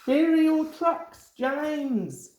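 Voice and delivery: high, cheeky little engine voice; high teasing tank engine. A narrator's reading. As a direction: tease like a small engine. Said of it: "said a little tank engine".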